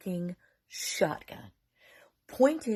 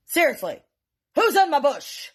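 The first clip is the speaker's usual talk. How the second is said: loud, higher-pitched demand; angry dad shout; 7 semitones higher, 6 dB louder than usual. Retold or imitated. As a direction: holler at a hidden intruder. Angry dad demands an answer, retold.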